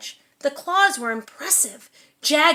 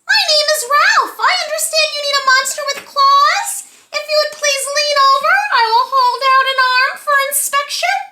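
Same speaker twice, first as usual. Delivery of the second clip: much higher and louder, silky-voiced monster; a dainty monster greeting, pitched way up; 11 semitones higher, 9 dB louder, faster than usual. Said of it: "he said in a high, silky voice".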